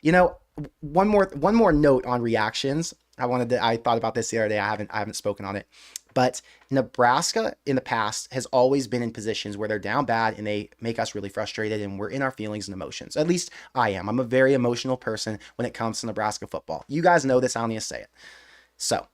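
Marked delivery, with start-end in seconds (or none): none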